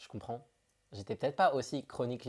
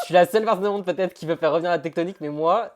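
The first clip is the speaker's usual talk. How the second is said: excited; 6 semitones higher, 10 dB louder than usual. Real, not acted. Excited about tectonik dance.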